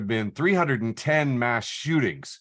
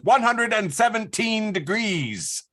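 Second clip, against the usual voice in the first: put-on old-timey reporter; 10 semitones higher, slower than usual. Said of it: "You sounded like an old-timey reporter".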